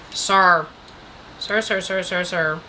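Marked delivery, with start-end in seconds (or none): none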